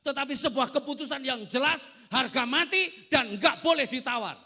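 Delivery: loud, high preacher's call; full force preaching. Acted. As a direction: thunder it from the pulpit. (khotbah berapi-api dengan suara keras)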